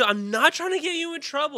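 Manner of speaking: high mock-protesting cop; imitated cop protest. Comic confirming how the cop said it, high imitated protest. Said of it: "Did he say it like that?".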